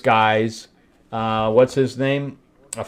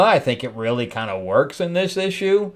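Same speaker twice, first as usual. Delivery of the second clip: ordinary delivery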